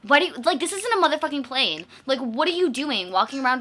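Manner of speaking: loud baffled rant at the snake man; incredulous yelling. Real, not acted. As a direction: shout it in total disbelief. Ranting in disbelief about snake.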